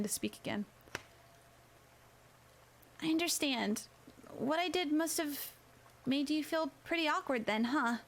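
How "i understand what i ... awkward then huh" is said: gentle, higher schoolgirl voice; a quieter, sincere girl; soft girl voice. A narrator's reading. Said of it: "Her voice was quiet and soft".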